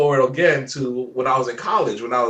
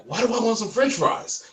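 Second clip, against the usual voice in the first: higher-pitched, amused self-questioning; mock puzzlement; 8 semitones higher, 3 dB quieter than usual. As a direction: wonder aloud at your own sudden craving, pitched up. Voicing the driver's puzzled thought.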